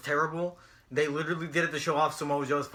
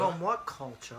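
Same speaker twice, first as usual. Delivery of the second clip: softer put-on UK accent; King Ross; 7 dB quieter, slower than usual. Echoing the co-host's impression, mock British accent. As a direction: echo the line in an imitated UK accent. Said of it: "King Ross does his accent".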